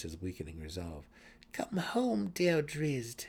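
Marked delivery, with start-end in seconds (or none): 1.5-3.3 high, coaxing purr. A narrator's reading. Brisa purrs a spell at her brother, coaxing. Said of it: "she purred".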